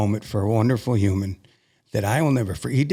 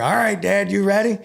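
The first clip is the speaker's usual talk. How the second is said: high, playful humoring tone; 10 semitones higher, 3 dB louder than usual. Retold or imitated. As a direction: humor her, talking to Dad. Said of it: "kinda humoring her".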